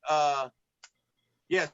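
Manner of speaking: ordinary delivery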